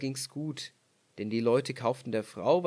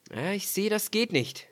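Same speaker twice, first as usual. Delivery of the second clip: leicht höher, knapp urteilend; Mann spricht; 7 semitones higher than usual. Acted. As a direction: ein Hauch von herablassendem Ehemann. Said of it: "sprach der Mann". (Ehemann sieht die wunden Hände, leicht gespielt)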